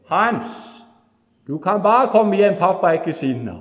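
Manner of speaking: loud, high friendly call. Retold or imitated. Brother calling Hans home, loud and kind. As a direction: call out to him, reassuring. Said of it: "gå ut og rope".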